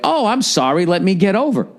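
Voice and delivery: high, put-on apologetic driver; sarcastic high-voiced apology; mock apology. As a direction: imitate a meek driver saying sorry, high-pitched. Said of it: "made the person in front of me go like".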